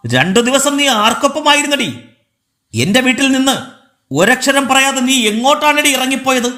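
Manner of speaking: high-pitched angry interrogation; acted outburst, demanding answers; explosive anger. A narrator's reading. Husband demanding where she went, explosive anger. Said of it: "അയാൾ പൊട്ടിത്തെറിച്ചു".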